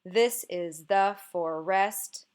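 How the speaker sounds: slow alternating beat, THIS is THE for-EST, hitting "This", "the" and the end of "forest"; forced trochaic stress. Shown as a demonstration. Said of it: "where the stress comes first".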